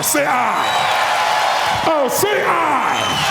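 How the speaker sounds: drawn-out "say aye" rally call; crowd-rousing shout. Real, not acted. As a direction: whip the room into shouting back. Prompting crowd response.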